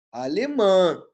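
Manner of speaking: Alemã, final MÃ drawn out and loud; stress on last syllable. Shown as a demonstration. Teacher answering own question on tonic syllable, stretched stress on Alemã. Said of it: "Qual é a sílaba tônica de alemã?".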